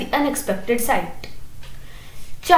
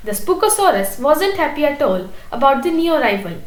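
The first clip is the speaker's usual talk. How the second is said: ordinary delivery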